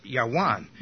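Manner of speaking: Yawan with W in place of V; ancient pronunciation. Shown as a demonstration. Teacher reconstructing the ancient name, Yawan said with a W. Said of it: "it probably would have been pronounced".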